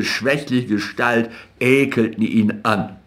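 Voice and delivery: ordinary delivery, nothing marked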